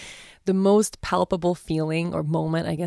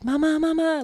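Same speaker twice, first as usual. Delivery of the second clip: put-on high, calling little-girl voice; scared toddler calling; 11 semitones higher than usual. Mother voicing her daughter waking from a nightmare, high calling. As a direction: call out like a frightened toddler.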